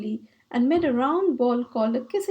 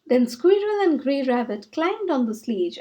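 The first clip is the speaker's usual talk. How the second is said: plain reading voice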